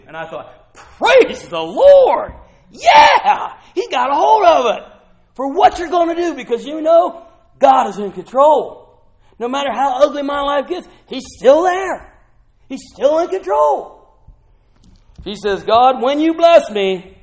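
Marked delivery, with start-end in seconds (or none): 0.7-14.1 loud, high, shouting praise; jubilant praise. Real, not acted. Preacher praising God for a friend's prayer, loud joyful shouting. 15.6-17.2 bold prayer. Retold or imitated. Quoting the prayer boldly.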